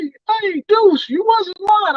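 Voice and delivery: high, excited friend's voice; imitating his friend. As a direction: squeak it out like an amazed buddy. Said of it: "He was like".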